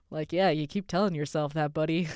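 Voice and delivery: ordinary delivery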